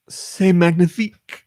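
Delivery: put-on French accent. Reading French comment.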